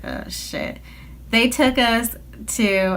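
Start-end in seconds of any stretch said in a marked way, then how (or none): 0.0-0.8 breathy panicked whisper under the breath; whispered curse in the back seat; whispered oh shit. Retold or imitated. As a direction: mutter it so the parents can't hear.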